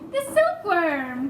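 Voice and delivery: eager cry. A narrator's reading. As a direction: burst out with the answer. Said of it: "cried the old green grasshopper".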